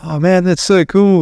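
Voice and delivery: high, drawn-out gushing; imitated admiration. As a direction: mimic an impressed fan of the accent. Imitates Americans admiring the accent.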